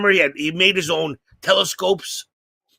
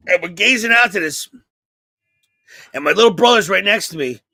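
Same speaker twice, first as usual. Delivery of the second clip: ordinary talk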